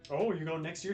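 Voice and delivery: usual speaking voice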